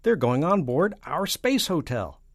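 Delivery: loud, high-pitched cry from the president; shouted. A narrator's reading. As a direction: keep shouting the alarm. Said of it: "shouted the president".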